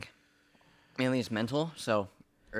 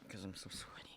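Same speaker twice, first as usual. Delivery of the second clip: whispering; 2 semitones lower, 16 dB quieter than usual. Real, not acted. Sweating from hot sauce.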